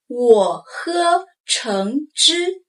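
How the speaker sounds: 我喝橙汁 slowly, each tone clear; slow model sentence. Shown as a demonstration. Teacher modeling 我喝橙汁 to repeat, slow and clear. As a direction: spell out 我喝橙汁 slowly for learners. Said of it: "Repeat after me".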